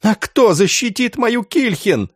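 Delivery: high-pitched grieving protest; anguished, keening question; sobbing. A narrator's reading. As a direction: plead tearfully for your dead daughter. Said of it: "прорыдал староста".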